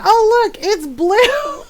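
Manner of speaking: high, rising exclamation; exclaiming. Retold or imitated. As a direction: pitch it way up, end on a rising BLUE. Mock reveal in retold argument.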